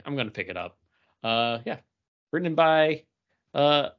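ordinary delivery

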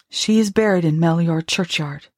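ordinary delivery

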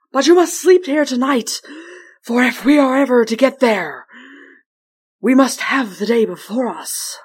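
mighty weary wind spirit; North Wind voice. Acted.

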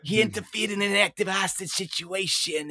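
high, cartoonish New York commissioner voice; second commissioner's accent. Another commissioner on a hostage situation, exaggerated New York accent. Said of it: "he sounds like this".

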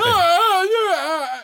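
high, loud mock wail; imitated crying. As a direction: wail like a man overcome on his knees. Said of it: "fell to his knees and started crying".

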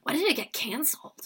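breathless secretive whisper. Retold or imitated. A teen fan whispers her love for a cancelled show. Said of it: "I was like".

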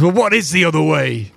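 exasperated demand. Acted. Drovak demands an alternative.

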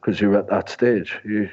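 normal talking voice

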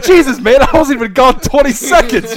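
high, loud shocked protest; exasperated disbelief. Retold or imitated. To a roommate caught after twenty seconds, exasperated disbelief.

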